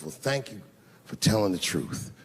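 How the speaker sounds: slow, low, sneering thanks that sours into an insult; sarcastic thanks. Retold or imitated. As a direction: drag out the fake gratitude, then spit the insult low. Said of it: "And I said to myself".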